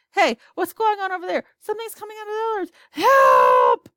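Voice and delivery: high, rushed panic rising into a loud cry for help; sudden terror, words tumbling out fast; panicked cry. Acted. Hotel manager on the radio, seeing something coming, panicked cry.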